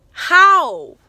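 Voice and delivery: loud, high, falling cry of amazement; amazed disbelief. Real, not acted. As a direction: burst out in real disbelief. Amazed at what God did.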